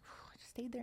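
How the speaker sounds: soft whisper after a breath out; hushed whisper. Retold or imitated. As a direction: whisper it, settling into the moment. Retells quietly staying with fear.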